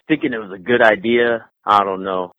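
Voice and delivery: ordinary talk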